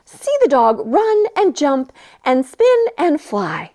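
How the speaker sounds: ordinary delivery, nothing marked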